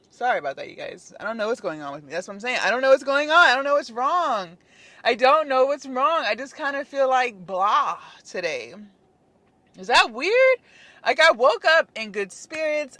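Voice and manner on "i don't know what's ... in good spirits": exasperated. Real, not acted. Venting frustration about workouts.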